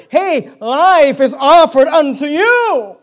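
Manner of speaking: drawn-out, high shout to a crowd; Moses calling out. Acted. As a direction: proclaim it loudly, stretching the words. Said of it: "imagine him singing these words and saying these words".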